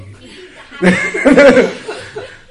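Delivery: real loud, high-pitched laugh. Real, not acted. Storyteller laughing over the fishless fishing trip, high laugh.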